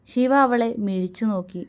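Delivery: ordinary delivery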